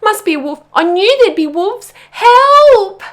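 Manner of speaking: high, loud panic building to a scream; panicked yelping. A narrator's reading. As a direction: cry for help in terror. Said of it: "yelped Pog".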